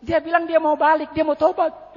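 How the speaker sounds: high-pitched distraught retelling; pitched high, acting out her hysterics; imitated hysterical wife. Pastor voices the wife reporting her husband's promise, hysterical. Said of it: "Setelah histeris dia berkata".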